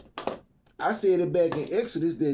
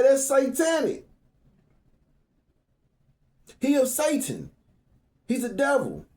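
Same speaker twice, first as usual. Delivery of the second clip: mock outrage; 8 semitones higher than usual.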